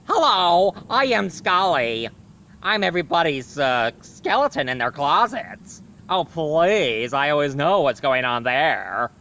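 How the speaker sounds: loud, showy cartoon skeleton voice; Scully the skeleton. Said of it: "he always talks like this".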